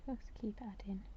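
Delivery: soft whisper while concentrating. Real, not acted. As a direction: whisper to yourself as you work.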